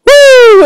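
piercing, loud high woo; ecstatic whoop. Real, not acted. Gamer still alive, ecstatic high whoop.

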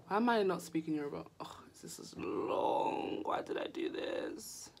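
hushed whispered aside. Real, not acted. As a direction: whisper it to yourself.

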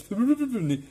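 high-pitched shivering cry; shivering whimper. Retold or imitated. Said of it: "he's, like, shivering".

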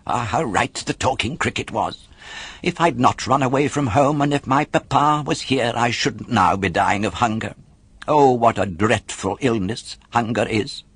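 plain reading voice

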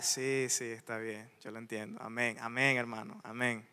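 low, soft nodding-along replies; mock passive agreement. Churchgoer answering the preacher, imitated quiet passive agreement. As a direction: agree under your breath, low and offhand.

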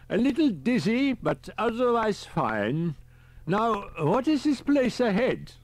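put-on high, fussy elderly professor; a high old professor calling on the radio; professor's voice. A narrator's reading. Riker, dazed.

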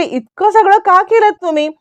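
high-pitched, mildly amazed question; astonished. A narrator's reading. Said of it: "आश्चर्याचा धक्काच बसतो".

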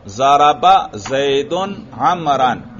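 slow, word-by-word recitation; slow repetition drill. Shown as a demonstration. Teacher has students repeat the example sentence, slow repetition drill. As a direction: lead the class through it slowly for repeating. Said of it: "फिर दोहराओ".

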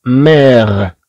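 لا مير syllable by syllable, slow; teacher models long vowel; slowly sounded out. Shown as a demonstration. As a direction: stretch every sound of لا مير. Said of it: "كما تلاحظوا نفس الشيء".

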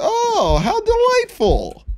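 very high, slow mock delight; sarcastic falsetto. Sarcastic at blood on walls.

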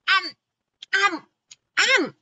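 high rising grunts; chomping grunts. A narrator's reading. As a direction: grunt like snapping jaws. Chomping sounds.

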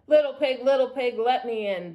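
loud, mildly menacing storybook bellow. A narrator's reading. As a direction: bellow at the door as the wolf. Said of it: "bellowed the wolf".

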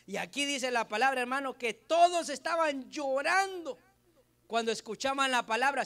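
preacher urging the church to shed a tear, loud; fervent exhortation. Acted. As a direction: rouse them, raise your voice. Exhorts congregation to weep.

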